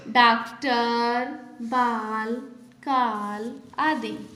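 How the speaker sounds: डॉक्टर, बाल, काल said slowly and loud with the vowel between आ and ओ; open o sound. Shown as a demonstration. Teacher demonstrates the English vowel, loud and slow. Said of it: "आ और ओ के बीच की ध्वनि".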